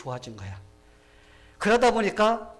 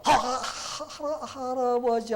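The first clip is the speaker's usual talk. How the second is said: high, loud, drawn-out frightened stutter; stammering; 10 semitones higher, 6 dB louder, much slower than usual. Acted. Young prince trying to speak to his grandfather the king, stammering. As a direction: stutter hard on the first syllable, dragging it out. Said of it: "말을 더듬으면서".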